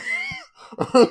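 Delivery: high squealing laugh; burst of laughter. Real, not acted. Telling the cheating backstory, squealing laugh. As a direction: crack up helplessly.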